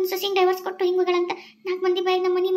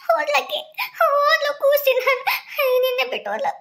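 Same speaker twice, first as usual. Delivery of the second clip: wailing; 11 semitones higher than usual. Acted. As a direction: cry out in grief as she walks away. Mother wails as wife leaves. (ಸೊಸೆ ಹೋದಾಗ ಅಳುತ್ತಾ ಗೋಳಾಡುವ ತಾಯಿ)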